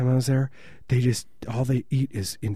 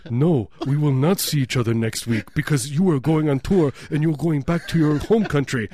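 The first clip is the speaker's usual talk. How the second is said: high, emphatic mock German accent; imitated German man; 4 semitones higher, 3 dB louder than usual. German host missing a guest's joke, mock German accent. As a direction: correct them literally, in hammed-up German. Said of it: "made a joke to this German guy".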